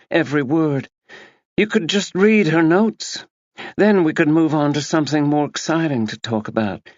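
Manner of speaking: a self-absorbed woman brushing off a murder; breezy Tina voice. A narrator's reading. Narrator voicing Tina to Max, breezy and dismissive.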